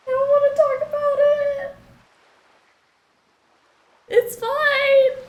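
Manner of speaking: very high, loud, tearful squeak; overwhelmed high-pitched protest; about to cry. Real, not acted. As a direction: squeal it out while holding back tears. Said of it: "I'm going to cry".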